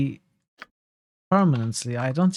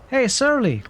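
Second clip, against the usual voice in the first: higher shout across the room; calling out; 11 semitones higher, 3 dB louder than usual. Acted. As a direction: hail the barkeep. Calls out to barkeep.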